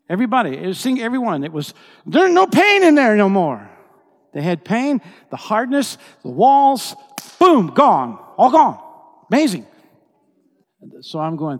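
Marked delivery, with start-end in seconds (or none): 0.1-9.7 high, loud preaching at full force; impassioned preaching. Real, not acted. Preacher proclaims the men's healing, full-force shouting. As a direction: hammer out each phrase in shouted bursts.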